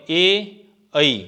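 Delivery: ए then a wider-mouthed ऐ; vowel contrast. Shown as a demonstration. Teacher contrasting ए and ऐ for students. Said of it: "ए और ऐ के उच्चारण में अंतर करके देखें".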